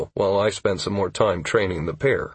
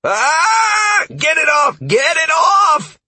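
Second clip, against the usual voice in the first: very high, loud panicked scream; shrill panic; 7 dB louder, slower than usual. A narrator's reading. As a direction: shriek for help, stretching the last word. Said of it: "Halcyon's voice shrilled".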